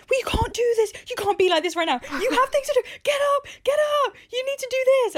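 high, loud nagging voice barking orders at herself; harsh inner critic. Retold or imitated. Inner voice nagging her to get up, loud and high-pitched. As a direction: scold yourself like a drill sergeant. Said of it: "if I feel like I'm putting pressure on myself".

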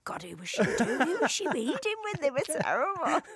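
high, increasingly hammed-up disbelief; mock appalled. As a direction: play a casting team aghast at bad acting. Imagined casters mock her reading.

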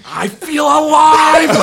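high, loud joyful yell; euphoric yell. Retold or imitated. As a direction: scream it with wild joy. Thrilled after being beaten up.

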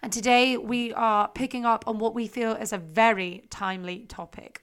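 usual speaking voice

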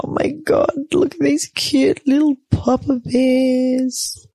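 high, drawn-out adoring squeal; imitating own melting baby talk; perked-up cooing. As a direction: gush over cute babies. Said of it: "all prepped up and go".

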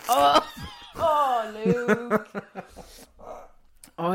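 laughing. Real, not acted. Laughing at him eating.

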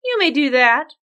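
scheming storybook princess; cartoon princess. Princess agrees slyly.

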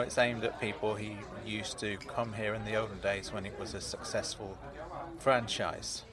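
ordinary talk

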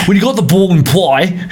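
"When you got the ball in play" lilted like a broad Aussie footy caller; rugby commentator twang. Retold or imitated. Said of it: "the commentators have a bit of a twang".

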